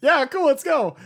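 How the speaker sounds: high, loud gung-ho quote; eager agreement. Retold or imitated. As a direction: shout it like you're totally up for it. Acting out Luffy's eager agreement.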